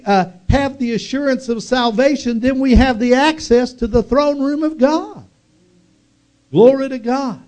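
high, loud, building preacher's cry; preaching at full force. Acted. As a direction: lift it to a shouting climax of praise. Preaching climax.

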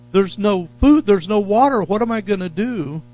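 hammed-up desert panic; mock panic. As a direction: play the panicked wanderer in the desert. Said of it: "we would freak out".

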